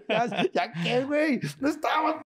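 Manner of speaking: mock defensive wife. Comedian voices his wife denying she was asleep, very high. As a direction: protest high and fast.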